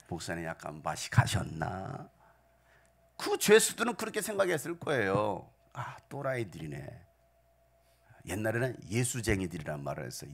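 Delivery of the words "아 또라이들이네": scornful whisper; whispered scoff. Retold or imitated. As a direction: whisper it like a sneering prisoner. Prisoners' mocking thought. (비웃는 속삭임)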